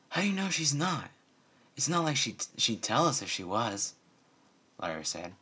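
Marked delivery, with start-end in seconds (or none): 0.1-3.9 higher, pressing protest; insistent. A narrator's reading. Lyra arguing with Bon Bon that Twilight might be a spy, insistent. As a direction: push back defensively.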